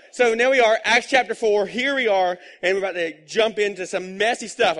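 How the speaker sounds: high, loud, rallying announcement; energized announcement. Real, not acted. Pastor launching the congregation into Acts four, high and loud. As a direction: pump the room up for what's next.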